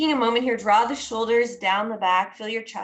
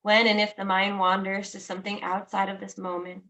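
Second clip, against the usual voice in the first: guided meditation. Acted. Teacher guiding listeners into noticing their breath, calm guided meditation. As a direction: ease each phrase out and let it settle.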